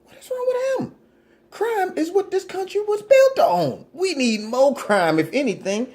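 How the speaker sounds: high, incredulous sarcastic squeal; mock outrage. Satirist pretending crime is patriotic, mock high outrage. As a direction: shoot the voice way up in fake disbelief.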